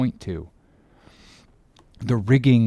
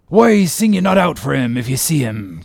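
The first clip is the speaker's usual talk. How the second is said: put-on raised, impatient old sea-captain voice; Ahab's cry; 3 dB louder than usual. A narrator's reading. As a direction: demand it of the lookouts. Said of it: "cried Ahab".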